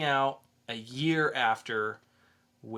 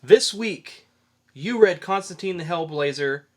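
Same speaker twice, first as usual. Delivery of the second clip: hyped intro; 2 semitones higher, 9 dB louder than usual. Real, not acted. Hosts open review segment.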